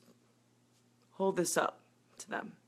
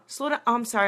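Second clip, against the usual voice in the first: normal talking voice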